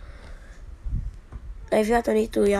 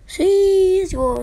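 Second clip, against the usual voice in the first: excited; 7 semitones higher, 5 dB louder, much slower than usual. Real, not acted. Walkout reveal.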